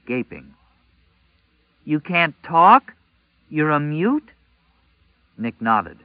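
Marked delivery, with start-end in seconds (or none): 1.9-4.3 high, slow, loud disbelief; drawn-out shocked question; disbelieving girl. A narrator's reading. Girl realizes he is mute.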